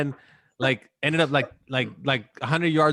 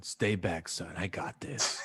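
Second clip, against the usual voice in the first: lower, softer, slow fatherly voice; a hint of a solemn dad; mock dad; 9 semitones lower, 10 dB quieter, slower than usual. Mocking the dad warning his son, lower and slow. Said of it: "his dad going".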